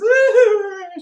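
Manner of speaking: high, loud mock wail; hammed-up panicked cry; freaked-out wail. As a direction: imitate a terrified shriek. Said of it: "freaked out".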